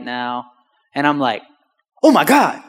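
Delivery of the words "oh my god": loud, high amazed exclamation; astonished. Retold or imitated. Driver discovers the truck works, loud astonishment. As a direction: blurt it out in hammed-up disbelief. Retells shock at truck speeding up.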